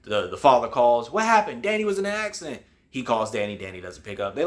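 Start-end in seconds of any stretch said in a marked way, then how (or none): 1.1-2.6 high-pitched mock alarm; alarmed exchange. Father calls Grandma about Danny, alarmed. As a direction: act out a worried call, pitched high.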